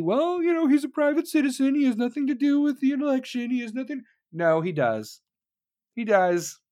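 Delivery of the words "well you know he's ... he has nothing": mocking high-pitched spokeswoman voice; Jen Psaki. Host mimicking an official defending Hunter, high mocking voice. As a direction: pitch it way up, imitating an official's excuses. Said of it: "if you ask Jen Psaki".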